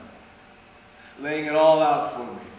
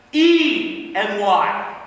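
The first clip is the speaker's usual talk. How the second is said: very slow, loud letters; emphatic letter-by-letter spelling; 2 semitones higher, 6 dB louder, much slower than usual. Acted. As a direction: spell it out loudly, stretching each letter. Spells out brother's misspelling.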